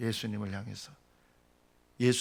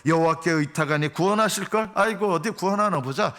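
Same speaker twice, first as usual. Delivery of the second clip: high, loud jeer; sneering mockery; 6 semitones higher, 5 dB louder than usual. Scoffers taunt David for trusting God, sneering. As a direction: scoff with a pout. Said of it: "나를 비웃으며 입술을 삐죽거리고".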